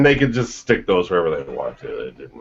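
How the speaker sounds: usual speaking voice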